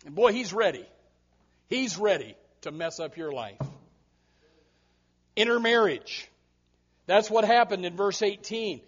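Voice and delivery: ordinary talk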